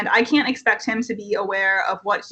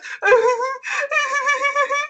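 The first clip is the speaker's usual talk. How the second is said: mocking fake sobs. Director mocking the camper's sobs up close, hammed-up fake bawling. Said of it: "he starts mocking my sobs".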